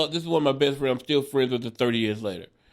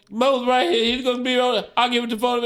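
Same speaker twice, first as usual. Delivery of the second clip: imitated upset brother; 9 semitones higher than usual. Drunk brother answering his mom on the phone, high mock fluster. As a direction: act out a flustered drunk, pitched well up.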